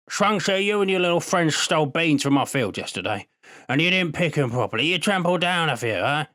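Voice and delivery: put-on high, teasing old man's voice; old farmer. A narrator's reading. Old Liu scolding the boys for stolen beans, teasing old voice.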